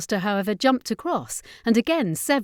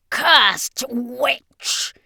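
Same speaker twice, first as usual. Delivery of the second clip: loud, higher vampire snarl in fury; scream of pain and anger; 5 semitones higher, 7 dB louder than usual. Acted. Wounded vampire curses the witch, furious scream. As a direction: spit the curse out in pain and rage. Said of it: "he screamed in pain and anger".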